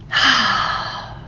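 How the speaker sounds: breathy, audible exhale; long relieved sigh. Real, not acted. Coach sighs out after leading calming breaths, relieved sigh.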